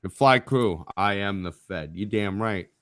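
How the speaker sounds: usual speaking voice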